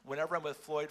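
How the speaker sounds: usual speaking voice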